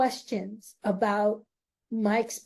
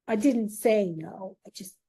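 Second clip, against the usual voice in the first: normal talking voice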